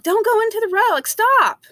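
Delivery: loud, high warning shout; yell at child. Retold or imitated. Parent yelling at a child near the road, loud and high. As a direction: yell it like a kid's running into traffic. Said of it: "you yell".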